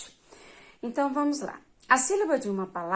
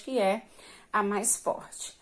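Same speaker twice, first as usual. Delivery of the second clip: ordinary talk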